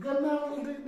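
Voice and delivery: गनार्ड with an audible G, "ga-naard"; wrong pronunciation. Shown as a demonstration. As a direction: demonstrate गनार्ड wrongly, G voiced before N. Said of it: "नहीं पढ़ेंगे ना".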